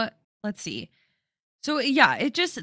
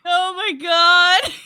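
delighted shock; 8 semitones higher, 6 dB louder, slower than usual. Real, not acted. Reacting to friend's rant.

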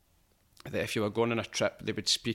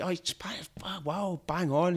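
impressed guide; 8 semitones higher, slower than usual. Retold or imitated. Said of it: "he's like".

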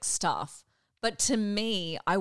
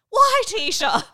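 exasperated mum; 12 semitones higher, 4 dB louder than usual. Retold or imitated.

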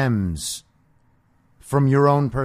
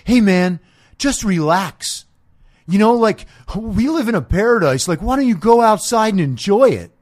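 higher, hammed-up chill hippie voice; mock laid-back dude; 5 semitones higher, 5 dB louder than usual. Dude telling the podcaster to relax, mock mellow voice. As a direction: imitate the mellow guy telling you to relax. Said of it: "I had a dude say to me".

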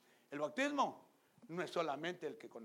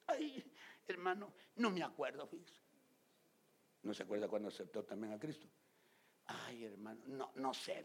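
mocking churchgoers; 3 semitones lower than usual.

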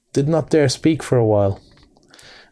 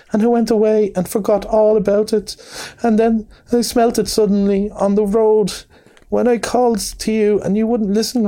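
high, stammering little mole, sobbing out his lost home; a heartbroken small creature stuttering through sobs; tearful Mole; 10 semitones higher than usual. A narrator's reading. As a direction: pour it out in broken, pitched-up grief. Mole pleads through tears.

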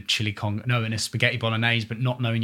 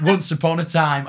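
put-on northern accent; 7 semitones higher, 6 dB louder than usual. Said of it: "gave myself a northern voice".

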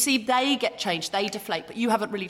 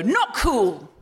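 firm and cool; 4 semitones lower than usual. Shown as a demonstration. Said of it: "I put both my arms up and I go".

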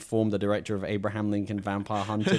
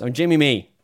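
mock Jimmy; 4 semitones higher, 9 dB louder than usual. Echoing the Pulp Fiction line.

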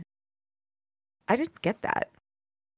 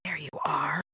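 much softer, low, creeping whisper; 5 semitones lower, 13 dB quieter than usual. Acted. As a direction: breathe it like a lurking thing in the dark. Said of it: "whispered one of the voices".

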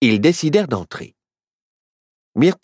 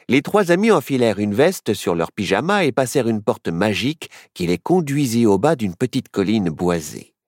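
usual speaking voice

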